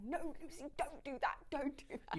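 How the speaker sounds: imitating a parent's hushed telling-off; parents telling off. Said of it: "so angry with me and saying".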